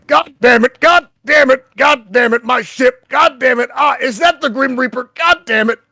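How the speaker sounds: loud, high-pitched gruff shouting. Acted. Gruff stranger cursing over communications from Olnik's planet, gruff shout. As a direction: holler curses in a worked-up gruff voice. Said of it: "kind of gruff voice go".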